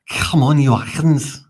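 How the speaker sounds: put-on slow, high, loud Welsh-accented chant; mock Welsh football chant. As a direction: sing it out like a Welshman on the terraces. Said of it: "Good Welsh impersonation".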